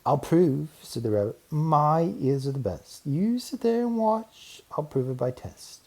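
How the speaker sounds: lightly voiced proud rabbit; eager rabbit. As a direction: show him you're sure. Rabbit proving himself.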